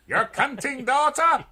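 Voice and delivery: high, loud, slow possessed screech; demonic voice. Acted. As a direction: drag it out like a demon.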